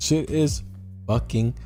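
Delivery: normal talking voice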